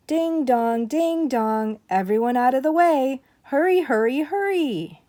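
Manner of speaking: loud sing-song clanging call; siren call. Acted. Firemen racing through town, calling loudly to clear the way.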